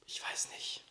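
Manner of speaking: quiet whisper. Real, not acted. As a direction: say it under your breath. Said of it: "Flüstern mal ein bisschen".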